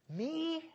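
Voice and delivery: "Me?" alone, very high and rising; trying emphasis on ME. Shown as a demonstration. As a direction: stretch "Me?" up into a disbelieving question. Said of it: "I don't know where to put the emphasis".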